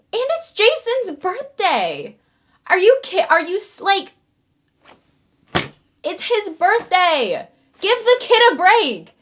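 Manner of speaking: loud outraged disbelief. Real, not acted. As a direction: protest loudly. Outraged at plot.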